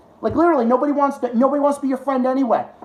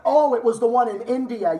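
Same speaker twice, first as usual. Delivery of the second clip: ordinary talk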